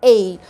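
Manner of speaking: a low, clear er-like vowel sound for "A"; the teacher models the mixed vowel slowly; English vowel demo. Shown as a demonstration. Teacher shows students the vowel sound, clearly modelled. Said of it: "بيتنطق صوت مائل شوية زي A".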